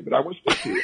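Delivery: breaks into a laugh on the last words; laughing while delivering the punchline. Real, not acted. Defiant punchline to grumpy man.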